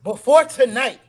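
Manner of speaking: loud, slow hype verses; hyped poem reading. Acted. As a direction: perform it like slam poetry. Reads his poem aloud.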